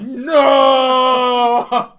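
long, drawn-out "no" yelled high; huge theatrical cry of protest; anguished mock shout. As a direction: wail it in hammed-up despair. Retold despair at being picked.

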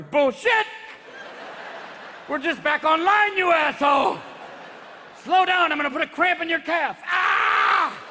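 high, furious mock shouting; protesting heart. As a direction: threaten loudly, then cry out. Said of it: "And your heart's going".

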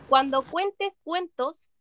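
slow, careful word-by-word recital; slow tongue twister. Real, not acted. Player reads a tongue twister to friends, slow and careful. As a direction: read it slowly, pausing between phrases. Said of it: "tienes que decirlo más rápido".

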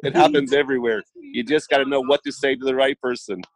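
confident advice. Retold or imitated. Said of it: "I said".

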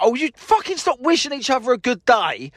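egging them on in mock frustration; exasperated. Real, not acted. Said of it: "cringing of embarrassment".

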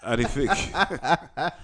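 slow, laughing "Arifik"; drawn-out Arifik. Real, not acted. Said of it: "what does Arifik mean".

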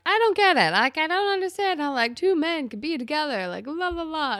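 loud, high mock protest; put-on voice of loud incomprehension; baffled dad imitation. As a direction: blurt it out like a bewildered dad. Voicing homophobic dad's confusion.

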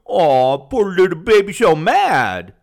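high, loud, mock-pitying coo; sneering baby coo at a furious young guy. Shown as a demonstration. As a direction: taunt him like a crying baby. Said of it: "that tone that I just had".

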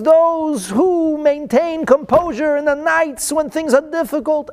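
high, impassioned sermon voice; preaching. Acted. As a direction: lift the verse with fervour. Psalm verses preached fervently.

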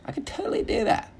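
very high squeaky put-on voice; squeaky boast. Comic bragging he could identify meat cuts, high squeaky voice. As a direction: shoot up into comic falsetto.

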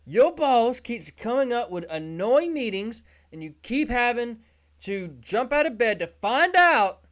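Zoom's pitch. Acted. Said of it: "Zoom jump on this mountain and say".

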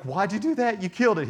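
high, loud retold outburst; shocked protest. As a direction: blurt it out high, aghast.

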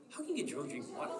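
mock disbelief at the teacher; incredulous question. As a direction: toss it off like it makes no sense. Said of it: "I'm like".

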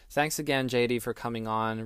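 ordinary talk

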